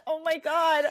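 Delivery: ordinary talk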